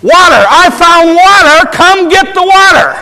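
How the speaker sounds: loud, high shout across a long distance; full-voiced, high far-carrying holler; calling out. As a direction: yell the news at the top of your voice. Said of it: "he calls out".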